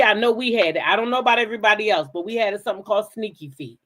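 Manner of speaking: normal talking voice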